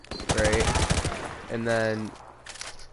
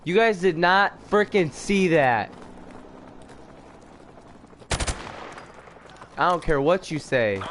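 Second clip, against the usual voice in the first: mad; 4 semitones higher than usual. Real, not acted. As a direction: insist on it, annoyed and raised. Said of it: "I'm pretty mad".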